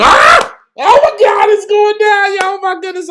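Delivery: high, loud scream as the fight starts; excited scream. Real, not acted. Fan screaming as the big fight begins. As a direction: shriek with pure hype. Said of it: "I'm sorry about screaming".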